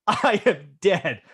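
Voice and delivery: slow doomed words through a laugh; a dad laughing at his own doom before his wife arrives; laughing dread. Retold or imitated. Hears commotion upstairs, awaiting wife.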